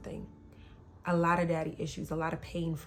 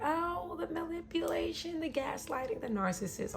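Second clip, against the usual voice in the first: exasperated; 11 semitones higher, 3 dB quieter than usual. Real, not acted. Woman recounting his gaslighting, exasperated. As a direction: let the exasperation ride high in the voice.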